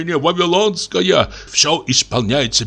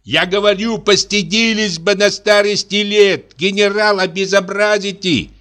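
louder; 7 semitones higher than usual. A narrator's reading. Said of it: "громко".

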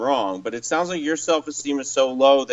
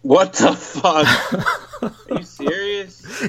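real laughter stretching out the words; slow, laughing disbelief; incredulous laughing; 6 semitones higher, slower than usual. Real, not acted. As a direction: react in disbelief, cracking up. Listener laughs in disbelief at story.

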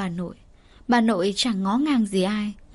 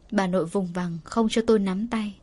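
ordinary delivery

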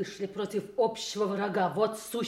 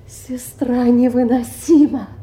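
loud, raised-pitch exclamation; exasperated outburst; 4 semitones higher, 13 dB louder than usual. Acted. Venting to a lover about an unbearable sister, loud outburst. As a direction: burst out loudly in exasperation.

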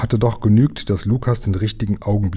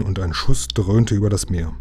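ordinary delivery, nothing marked

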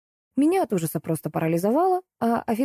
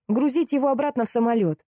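raised-pitch cross demand; angered; 6 semitones higher, faster than usual. A narrator's reading. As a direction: insist crossly, without shouting. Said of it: "обозлилась я". (сердитое требование)

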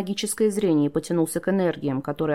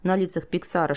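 ordinary delivery, nothing marked

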